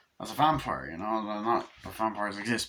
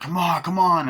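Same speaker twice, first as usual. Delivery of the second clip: loud high mock yell in a fight; rally it like swinging a torch; mock battle yell; 8 semitones higher, 7 dB louder than usual.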